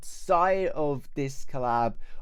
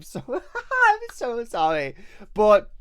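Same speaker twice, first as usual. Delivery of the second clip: laughing; 8 semitones higher, 4 dB louder, slower than usual. Real, not acted. Embarrassed apology about too many videos.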